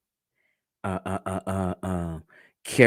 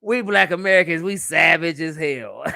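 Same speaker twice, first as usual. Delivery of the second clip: proud declaration; 5 semitones higher, 5 dB louder than usual. Retold or imitated. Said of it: "I said".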